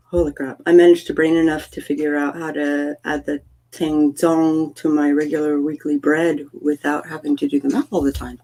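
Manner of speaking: ordinary talk, nothing marked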